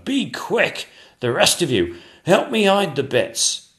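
a foreman urging on the crew; urgent cried order. Acted. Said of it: "cried the chief royal painter".

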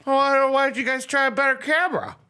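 high, loud mock dumb question; mocking clueless voice. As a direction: play the clueless reporter, high-pitched.

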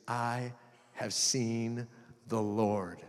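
soft, low, very slow declaration; slowly, softly, with space between phrases; hushed reverence. A narrator's reading. Mary tells the disciples the news, hushed and slow.

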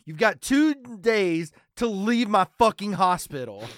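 loud, slow, high mock tirade; Johnny C. Imagined TV doctor ordering patient out of hospital, slow loud bluster. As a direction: play an overbearing doctor laying down the law. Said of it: "imagine Johnny C being like".

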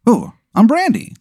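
high-pitched sudden realization; Brandy remembering herself. Acted. Game master playing the player's character as she remembers, high realization. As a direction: jump high, as if it just came back. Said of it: "And then you go".

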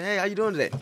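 friendly greeting. Retold or imitated. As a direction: greet the clerk brightly, high and light. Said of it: "I'm coming in".

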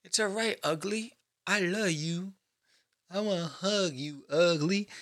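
soft, hug-like reassurance to the ugly; mock-tender lullaby tone to a bad drawing. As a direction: coo at your ugly drawing like a pet. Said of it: "embrace the ugly".

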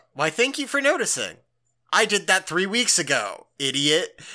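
a teacher needling her pupil with fake politeness; sarcastic teacher. Teacher snipes at Ichigo over her hairstyle, sarcastically high. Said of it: "And she goes".

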